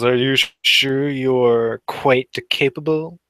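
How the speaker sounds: slow, drawn-out doubtful question; sceptical. Acted. Aiden giving Tony side eye over another drink, sceptical. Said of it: "gives Tony a bit of side eye".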